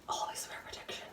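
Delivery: a sudden whispered aside about the gloves. Pretend. Mock-serious whisper while pulling on gloves.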